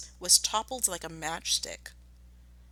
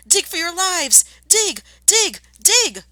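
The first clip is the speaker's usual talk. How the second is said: much higher, much louder urgent commands; frantic shout; 12 semitones higher, 11 dB louder, slower than usual. Acted. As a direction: yell to the family to dig for their lives. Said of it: "shouted Mister Fox".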